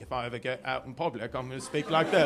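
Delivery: a lightly put-on, fake posh accent; mock a New Jersey guy faking an accent in public, lightly; made-up fancy accent. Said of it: "you make up an accent".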